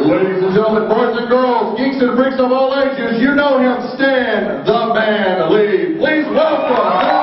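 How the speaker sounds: announcer intro. Acted. Introducing Stan Lee to the crowd, shouted ring-announcer style.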